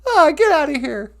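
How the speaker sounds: high puppet brushing off a compliment; flustered puppet. Acted. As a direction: wave it off like a blushing puppet.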